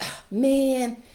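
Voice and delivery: put-on high, loud whine of a kid; whiny kid. Child whining when told to clean up toys. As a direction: groan like a child told to tidy up. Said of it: "They're like".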